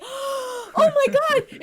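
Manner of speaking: high, slow mock gasp of shock; shocked bartender. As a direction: mimic her horror, drawn out and high. Imitates shocked bartender being yelled at.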